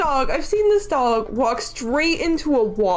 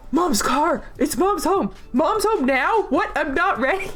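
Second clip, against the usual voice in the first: excited dog voice; 6 semitones higher than usual. Pretend. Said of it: "She was like".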